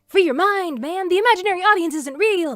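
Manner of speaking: loud, high rallying cry; excited. Acted. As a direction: pump them up like a hippie guru. Hyped pep-talk exclamation.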